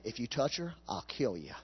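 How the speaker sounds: low, soft, menacing warning; quiet threat. Acted. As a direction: drop the voice down to a deadly calm threat. God threatens the Egyptian men.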